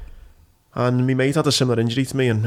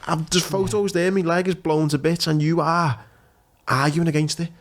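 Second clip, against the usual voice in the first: retold outburst; 6 semitones higher than usual. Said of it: "And I'm like".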